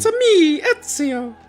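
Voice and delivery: very high cartoon Italian voice; Mario impression. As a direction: squeak it like Mario. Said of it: "Don't make that joke".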